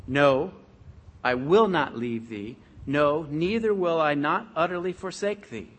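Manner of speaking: loud, weighty stress on each negative; emphatic reading. Acted. Preacher stresses the negatives to the church, loud and emphatic. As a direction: hammer home every "No" and "not". Emphatic literal translation read out.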